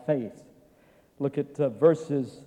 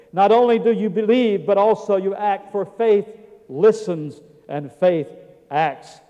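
loud, raised-pitch pulpit preaching; 6 semitones higher, 9 dB louder than usual. Acted. Preacher proclaiming grace and faith to the congregation, forceful. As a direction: drive the scripture home with force.

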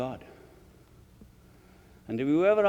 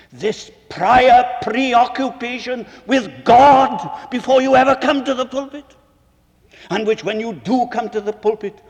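fiery preaching; 5 semitones higher, 13 dB louder than usual. Acted. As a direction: let the sermon build to a blazing climax. Climax of the sermon.

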